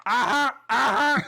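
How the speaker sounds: high, mocking forced laughter. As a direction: fake a cheesy laugh. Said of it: "scene is".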